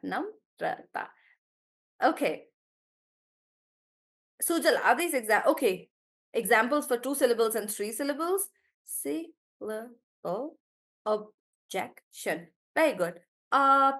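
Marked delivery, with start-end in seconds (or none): 0.0-1.1 Namrata said slowly, softly, syllable by syllable; slow syllable demo. Shown as a demonstration. Teacher breaking a student's name into syllables, slowly. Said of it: "So, Namrata, like that". 8.8-12.5 syllable objection drawn out slowly and softer; slow syllable demo. Shown as a demonstration. Teacher breaking words for the class, slow and soft. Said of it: "teacher demonstrates syllable breaking".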